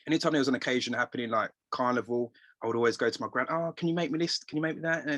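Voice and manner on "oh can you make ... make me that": lightly voiced, higher-pitched child's plea; a hint of a child begging a favour; pleading grandchild. Asking grandmother to make an outfit, lightly pleading.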